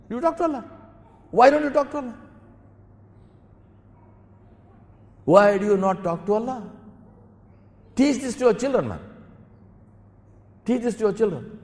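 high-pitched, insistent exhortation; impassioned preaching. Real, not acted. Preacher telling parents what to teach children, insistent. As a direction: urge them hard, pitched right up.

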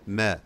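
"ma" with a thin, fronted a; corrected light ma. Shown as a demonstration. Teacher repeats the correct syllable, light vowel. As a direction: lighten "ma" toward "meh". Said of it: "not ma".

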